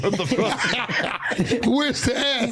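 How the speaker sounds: high laughing confusion; laughing disbelief. Real, not acted. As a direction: squeal through laughter.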